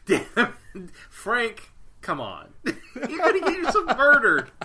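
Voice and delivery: joking through laughter; laughing. Real, not acted. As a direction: crack up as you say it. Joking retort amid laughter.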